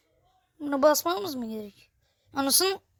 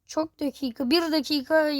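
normal talking voice

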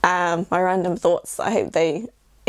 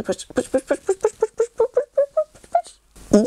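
each push climbing higher in pitch; rising pushes. Pretend. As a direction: push the voice up step by step. Pushing someone up, rising.

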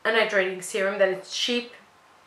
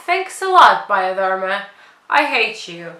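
loud, biting mock gratitude; sarcastic thanks; 8 dB louder than usual. Real, not acted. Sarcastically thanking Bioderma for discontinuing, loud and biting. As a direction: throw the thanks back with sarcasm.

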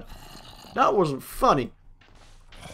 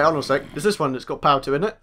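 ordinary talk, nothing marked

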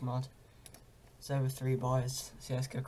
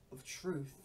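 usual speaking voice

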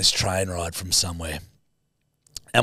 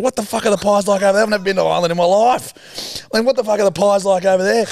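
fast, higher, louder panic; panicked inner thought; 9 semitones higher, 4 dB louder, faster than usual. Retold or imitated. Teen panics inside about Irish pies, fast and high. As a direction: race through it in a panic. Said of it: "And I'm thinking".

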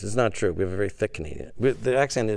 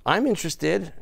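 high, eager listener voice; attentive reassurance; 6 semitones higher than usual. Acted. Canadians meaning to reassure the storyteller, high attentive voice. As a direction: show keen polite interest, pitched up. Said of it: "we're saying".

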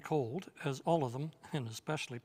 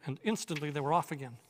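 usual speaking voice

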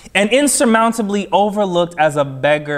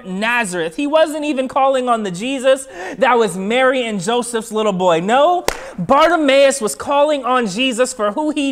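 high, fast, driving sermon delivery; emphatic preaching; 5 semitones higher, faster than usual. Real, not acted. Preacher tells congregation who Bartimaeus was calling on, impassioned preaching. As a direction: drive each repeated clause home, high and quick.

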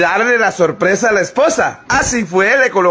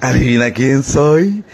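low, slow, voiced murmur; mock whisper in the ear; 8 semitones lower, slower than usual. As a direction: play a sly secret murmured into an ear. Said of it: "le susurra al oído".

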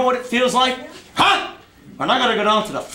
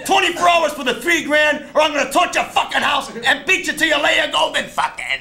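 furious threat; 3 semitones higher than usual. Acted. Uncle shouting a threat at the man who owes money. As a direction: bark the deadline like you mean it.

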